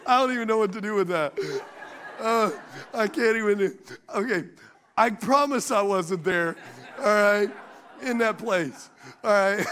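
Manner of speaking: trying to talk through laughter; protesting while fighting the giggles; helpless laughter. Real, not acted. Preacher losing composure before the congregation, laughing. Cracking up, cannot continue.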